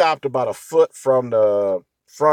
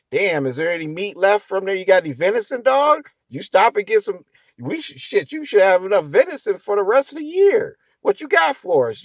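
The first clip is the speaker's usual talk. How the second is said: mocking joking coworkers; 7 semitones higher than usual. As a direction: rib him like a coworker laughing at the dented car. Coworkers joking about venison.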